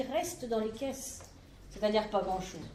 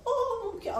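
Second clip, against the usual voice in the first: vieille choquée; 10 semitones higher than usual. Acted. Vieille dame choquée du prix.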